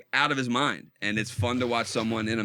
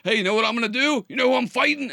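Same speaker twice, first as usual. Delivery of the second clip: higher, louder and fast, hyped up; manic excitement; 9 semitones higher, 5 dB louder, faster than usual. Retold or imitated. Manic father hyping up his son about the fight, excited. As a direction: talk fast like you can't sit still. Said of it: "their dad just being manic".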